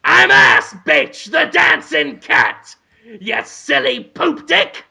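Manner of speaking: shouted, high cartoon cat voice; brash talking cat, drawn-out words; Willem Dafoe. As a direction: announce yourself like a loud, rude cat.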